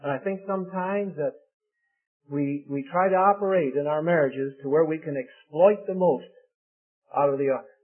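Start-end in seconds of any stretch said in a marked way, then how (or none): none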